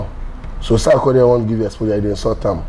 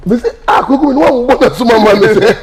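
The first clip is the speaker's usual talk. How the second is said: high, laughing through the words; 8 semitones higher than usual. Real, not acted. Joking with a friend, laughing through it. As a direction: tell it while cracking up.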